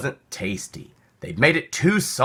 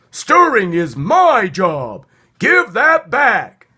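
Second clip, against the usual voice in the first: high, loud, slow squeak that climbs higher; insist, squeaking ever higher; squeaky protest; 10 semitones higher, 7 dB louder, slower than usual. Acted. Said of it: "squeaked the squirrel".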